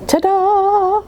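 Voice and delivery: high proud ta-da; showy ta-da. Acted. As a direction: present yourself with a flourish. Showing style.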